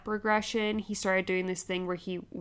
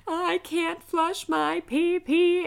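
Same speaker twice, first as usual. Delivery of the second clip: high, loud, slow whiny wail; screaming toddler; 10 semitones higher, 5 dB louder, much slower than usual. Retold or imitated. Mom imitating her toddler screaming through the house, high and slow. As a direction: whine it out like a furious little boy. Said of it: "running around the house screaming".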